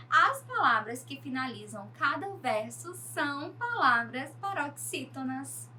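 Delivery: normal talking voice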